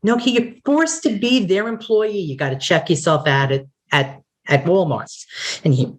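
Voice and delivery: ordinary delivery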